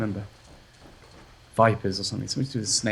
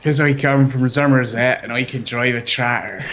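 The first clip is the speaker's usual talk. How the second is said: put-on slow Somerset country accent; hammed-up rural Somerset accent, drawn out; country folk accent. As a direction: lay on a slow West Country yokel accent. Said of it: "I'm allowed to do the accent".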